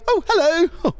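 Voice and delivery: very high, delighted hello back; naively friendly greeting. Acted. Naive international student greeting back a cyclist, high friendly voice. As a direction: answer as if flattered by a stranger. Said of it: "they're so friendly".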